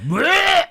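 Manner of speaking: very high retching blech of disgust. Real, not acted. Reacting to the attitude-of-gratitude shirts, high retching blech. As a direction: gag at the idea.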